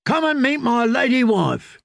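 high-pitched tipsy invitation; drunken singer. A narrator's reading. Singer shows off his new wife, drunken high call. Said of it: "the singer called out drunkenly".

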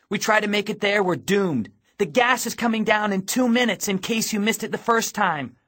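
urgent, exasperated warning. A narrator's reading. Girl argues with boy as gas nears, urgent and impatient. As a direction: drive it home, no time to waste.

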